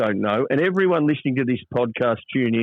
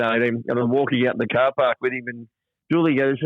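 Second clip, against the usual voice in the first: normal talking voice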